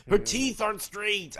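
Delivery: high-pitched hammed-up dismay; mock horror. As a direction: cry it out as if appalled, overdone. Mock horrified reaction continues.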